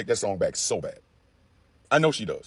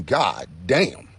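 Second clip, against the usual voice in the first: ordinary talk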